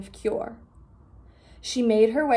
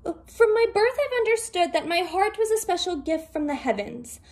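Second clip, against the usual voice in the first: high, composed rabbit voice; calm clever rabbit; 6 semitones higher than usual. Acted. Rabbit calmly tricking the Dragon King, high voiced. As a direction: explain calmly in a raised voice.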